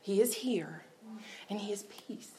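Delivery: whispery, very soft imitated comfort; hushed reassurance. Best friend on the phone calming her, whispered reassurance. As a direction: repeat it as a calming hush.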